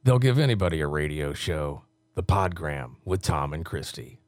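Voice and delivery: low, punchy promo read; low-pitched radio imaging voice; radio announcer. Acted. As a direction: deliver it like a station liner. Records promo liners in announcer voice.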